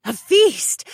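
delighted cry. Acted. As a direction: burst out with joy. Imp woman gasps.

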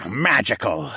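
hissed venomous word. A narrator's reading. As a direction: hiss it with contempt. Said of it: "he hissed".

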